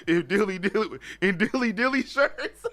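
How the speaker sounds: words breaking into high laughter; cracking up reading it out; laughing. Real, not acted. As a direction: laugh through the end of the line. Reading a funny chat comment.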